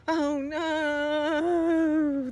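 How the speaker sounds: high, loud cry of alarm; falling cry. Acted. Little egg cries out while falling from the tree, high and loud. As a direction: yell out as you fall. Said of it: "the little egg cried as he was falling".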